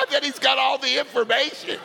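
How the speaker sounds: high, loud laughter around the words; laughing. Real, not acted. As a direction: laugh hard at your own joke. Laughing at his own joke.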